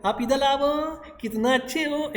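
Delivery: mock doting baby talk to a toddler; pampering baby talk. Shown as a demonstration. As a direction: coo at a baby the way doting parents do. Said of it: "ऐसे बोलते हैं, हम clear नहीं बोलते हैं".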